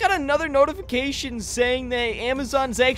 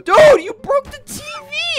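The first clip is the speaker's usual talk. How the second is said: high, loud outburst; outraged yell; 8 dB louder than usual. Pretend. Player yelling at friend over the broken TV, outraged yell.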